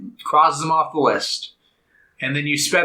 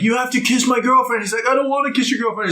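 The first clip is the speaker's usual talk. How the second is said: acted bickering; 8 semitones higher, much faster than usual. Acted quarrel between two characters.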